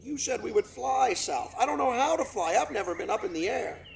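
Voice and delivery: put-on child cygnet talking; young cygnet. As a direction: speak up like an eager little brother. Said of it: "One of his brothers spoke up".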